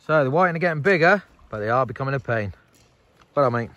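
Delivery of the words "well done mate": normal talking voice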